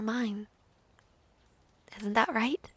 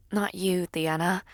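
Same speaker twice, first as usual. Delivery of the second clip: ordinary delivery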